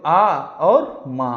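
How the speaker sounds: slow syllable-by-syllable आ और मा; spelled out slowly. Shown as a demonstration. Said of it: "हिजा करके पढ़ेंगे". (धीरे-धीरे हिज्जे करके)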